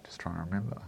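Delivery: barely voiced musing, eyes searching; whispered to self. Real, not acted. Editor recalling commissions, whispering to himself. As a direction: whisper it while searching memory.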